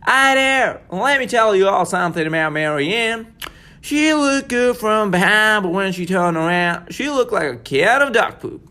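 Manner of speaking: a cowboy accent telling a tale; folksy cowboy accent. Shown as a demonstration. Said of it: "U S cowboy".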